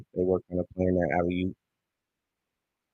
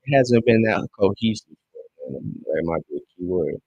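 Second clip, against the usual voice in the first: ordinary delivery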